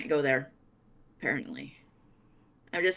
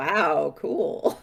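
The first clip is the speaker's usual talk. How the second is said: laughing. Pretend. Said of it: "look down and be like".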